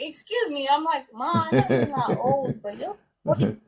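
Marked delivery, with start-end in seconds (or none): 0.0-0.7 high, affronted double take; retold disbelief. Retold shock at mom's pregnancy. 1.1-3.6 high-pitched mock pleading with mom; incredulous protest. Acted protest to pregnant mom.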